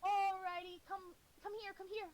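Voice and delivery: high, coaxing then stern voice; scolding owner. Acted. Someone calling their dog away from food. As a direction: call a dog back and scold it. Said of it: "You hear somebody saying".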